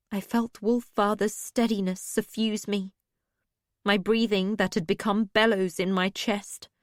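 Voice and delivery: ordinary delivery, nothing marked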